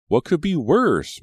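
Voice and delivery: usual speaking voice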